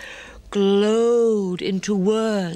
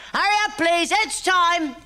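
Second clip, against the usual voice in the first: much higher, ringing closing-time call; barman's call; 12 semitones higher than usual. Acted. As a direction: call last orders across the pub.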